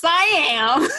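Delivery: squealed high and loud; excited reply. Retold or imitated. Player telling her mentor her jersey number, squealing excitedly. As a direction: gush it out with glee.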